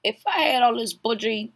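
ordinary delivery

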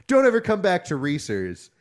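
3 hurl it after them as they leave; 4 imitated guard yelling a ban, slow and loud; 1 parting yell.

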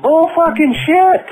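high, loud, slow furious curse; real furious shout at a prank caller; angry shout. Real, not acted. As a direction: bellow it out, fed up.

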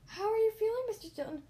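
loud, high cry of concern; high-pitched shouted question; shouting. A narrator's reading. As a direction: call out loudly over a crowd. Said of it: "Everyone was shouting".

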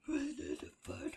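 laughing in disbelief. Real, not acted. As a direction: crack up mid-question. Reacting to odd voice attempt.

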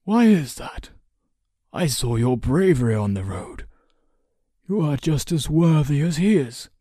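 high, loud, lilting persuasion; encouraging counsellor. A narrator's reading. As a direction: press the boy with praise.

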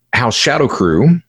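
usual speaking voice

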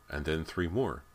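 usual speaking voice

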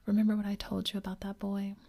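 ordinary delivery, nothing marked

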